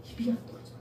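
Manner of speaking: a stuck, frozen whisper; hushed whisper. Retold or imitated. Pastor telling children, whispering that the mouth won't open. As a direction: breathe it out unvoiced, hardly a sound. Father's scene, can't speak.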